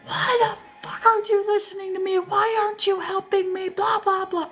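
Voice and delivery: high furious screaming, quoting him; imitated rage screaming. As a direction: shriek it out in a retold rage. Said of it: "I mean, full on rage screaming".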